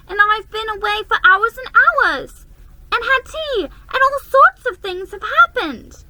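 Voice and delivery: put-on high, loud protesting child; a little girl protesting she was gone for hours; high insistent little girl. A narrator's reading. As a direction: insist earnestly. Lucy insists.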